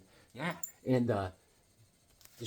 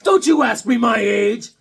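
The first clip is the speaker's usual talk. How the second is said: loud high indignant snap; woman snapping at rude question; offended shout; 7 semitones higher, 8 dB louder than usual. Retold or imitated. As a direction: bite back in outrage. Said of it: "you say".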